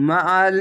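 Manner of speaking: heavy guttural ain; throaty ain. Shown as a demonstration. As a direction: press the throat on the ain of মাআল. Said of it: "আইনটিকে গলা চিপে মোটা করে".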